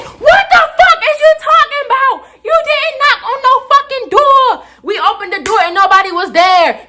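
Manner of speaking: very high, shouted outrage; making a scene. Retold or imitated. Student disputing the door knock, high shouted outrage. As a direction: shriek the denial, then ease slightly. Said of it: "I'm putting on a whole motherfucking scene".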